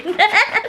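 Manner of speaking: quick high giggle; high laugh. Real, not acted. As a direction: giggle upward. Laughing at voodoo joke.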